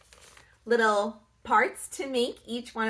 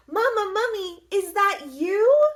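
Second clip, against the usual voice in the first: high, calling out into the dark; little mummy voice; 8 semitones higher, 5 dB louder than usual. A narrator's reading. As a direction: call out like a small lost child.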